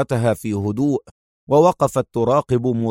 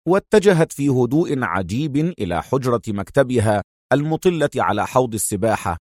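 ordinary delivery, nothing marked

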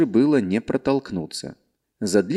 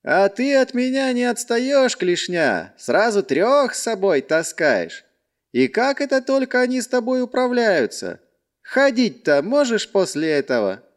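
mocking calm; 11 semitones higher than usual. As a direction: needle him with a smile. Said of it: "чуть улыбнувшись".

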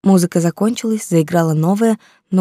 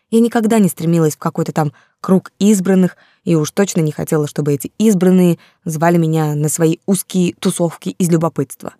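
usual speaking voice

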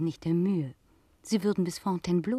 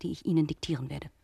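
ordinary talk, nothing marked

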